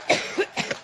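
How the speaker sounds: breathy unvoiced cough; real cough; coughing fit. Real, not acted. Coughs through her speech.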